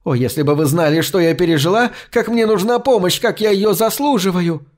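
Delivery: beg, voice climbing high; pleading crone. A narrator's reading. (жалобно, всё выше)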